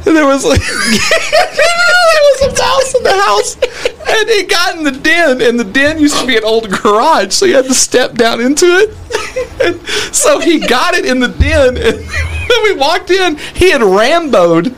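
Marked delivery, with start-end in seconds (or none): 0.1-5.4 slow, high words through laughter; laughing high. Real, not acted. Laughing hard mid-story. 9.6-14.6 crying with laughter. Real, not acted.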